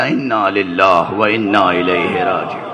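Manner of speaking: low, slow Arabic recitation; grave, measured recited response; solemn recitation. Retold or imitated. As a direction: intone it slowly and low, drawing out the syllables. Quotes Ali's reply, Arabic verse.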